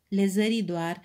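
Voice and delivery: usual speaking voice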